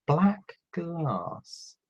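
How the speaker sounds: ordinary talk, nothing marked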